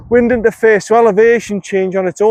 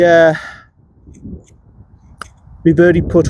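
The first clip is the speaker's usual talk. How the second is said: ordinary talk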